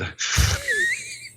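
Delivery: high-pitched burst of laughter. Real, not acted. Laughing at his own cancer joke, high pitched. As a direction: crack up at the punchline.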